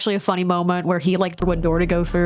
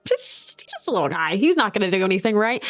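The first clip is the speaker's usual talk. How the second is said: ordinary delivery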